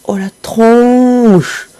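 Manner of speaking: high, stretched-out "oh"; amused exclamation. Real, not acted. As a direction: squeal at the funny face. Reacts to Link's face. (exclamation amusée)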